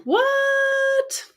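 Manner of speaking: sudden very high startled what; a startled squeak of confusion; surprised what. Real, not acted. Reacting to a chat message, a high surprised squeak.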